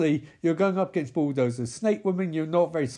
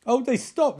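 ordinary talk, nothing marked

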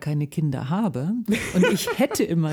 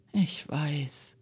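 put-on softly murmured, resigned boy; 3 semitones lower, 7 dB quieter than usual. [name] resigned to fetching the ball, softly murmured. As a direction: mumble it, resigned to the task. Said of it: "murmelte ich".

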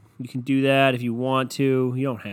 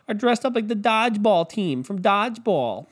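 mock gushing anchor; 6 semitones higher than usual. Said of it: "And they showed up Sunday".

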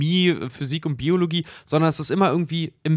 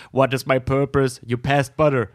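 slow, sad little robot voice; rusty robot; slower than usual. Retold or imitated. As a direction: voice the butter robot slowly. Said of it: "diesen kleinen Roboter baut".

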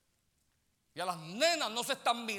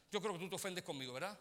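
ordinary delivery

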